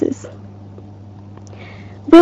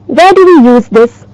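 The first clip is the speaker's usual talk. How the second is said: usual speaking voice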